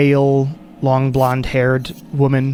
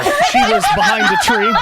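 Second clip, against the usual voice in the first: higher, laughing narration. Real, not acted. Game master adds a forgotten companion, laughing. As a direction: talk through the group's laughter.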